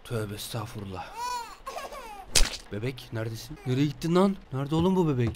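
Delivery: very low, hushed voice; spooked muttering. Real, not acted. Player looking for the vanished baby, wary low murmur. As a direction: murmur it uneasily under the breath.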